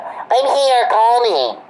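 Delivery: high, singled-out mock quote; mocking call-out. Imitating someone asking for a call, high mocking voice. Mimics a caller announcing arrival.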